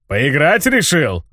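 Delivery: slow, mocking monster laugh in the words. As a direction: chuckle it out as a huge beast. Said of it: "рассмеялся монстр".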